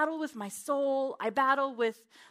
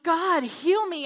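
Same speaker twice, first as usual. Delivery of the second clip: fervent plea; 6 semitones higher, 7 dB louder, slower than usual. Retold or imitated. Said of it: "So I'm like".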